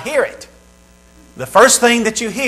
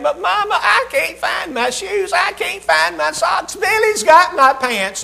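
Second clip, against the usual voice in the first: put-on whiny kids yelling; 10 semitones higher than usual. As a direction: whine like a child who lost his shoes. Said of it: "the kids are yelling".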